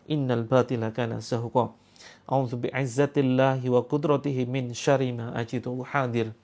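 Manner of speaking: fast, soft recitation of protective prayers; rapid quiet ruqyah recitation; murmured prayer. Acted. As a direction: rattle off the prayer under the breath.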